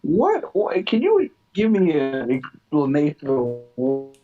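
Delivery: ordinary delivery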